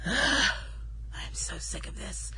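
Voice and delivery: sighing, whispered under the breath; a slow secret whisper of being fed up. Real, not acted. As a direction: breathe it out so nobody hears. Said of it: "I have to say that quietly".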